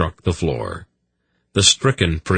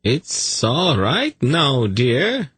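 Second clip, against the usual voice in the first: slow, high sing-song reassurance; cooing, drawn-out comfort; soothing; 8 semitones higher, much slower than usual. A narrator's reading. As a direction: coax a touchy pet gently. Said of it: "he said soothingly".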